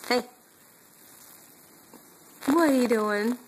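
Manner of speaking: ordinary talk, nothing marked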